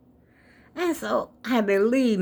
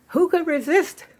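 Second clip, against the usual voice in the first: playful excitement; 11 semitones higher than usual. Real, not acted. Playful rhetorical question about the sale.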